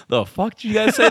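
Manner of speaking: high, loud mock outrage; retold irate instructor. Retold airborne instructor confronts trainees.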